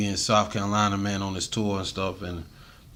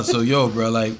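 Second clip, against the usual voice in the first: ordinary talk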